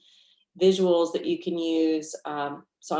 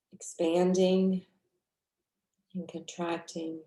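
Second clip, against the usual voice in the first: guided meditation. Real, not acted. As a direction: guide them gently through the breath, unhurried.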